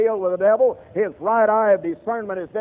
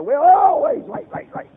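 furious yell; 12 semitones higher, 8 dB louder than usual. Retold or imitated. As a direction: blow up on the word always. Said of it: "he yells at his wife".

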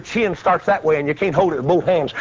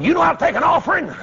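high, fast demanding question; shouted challenge; 10 semitones higher, faster than usual. Retold or imitated. Challenging the scared men.

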